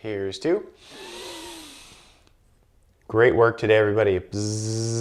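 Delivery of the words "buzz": lips buzzing on a steady breath; a long bee-like buzz. Shown as a demonstration. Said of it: "I'm gonna buzz three times".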